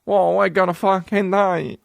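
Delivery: very broad, high British caricature; mock British accent. British actor as a despairing Russian, mock British accent. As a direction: bend the vowels into an overdone British accent. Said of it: "literally British".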